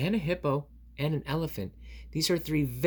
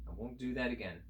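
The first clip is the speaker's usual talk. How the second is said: much softer, meek promise; sheepish; 9 dB quieter, faster than usual. Acted. Scolded reader answers friend, meek and soft. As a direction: mumble it like a scolded child.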